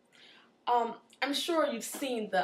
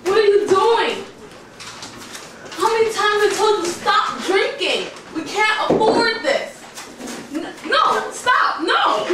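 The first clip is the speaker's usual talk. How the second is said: yelling wife; 10 semitones higher, 10 dB louder, slower than usual. Acted. Wife yelling at drunk husband.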